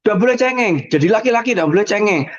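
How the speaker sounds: high, lightly stern adult scolding. Retold or imitated.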